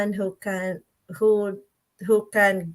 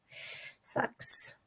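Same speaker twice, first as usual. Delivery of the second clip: whispering. Real, not acted. Whispered lament.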